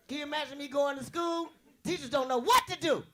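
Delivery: ordinary talk, nothing marked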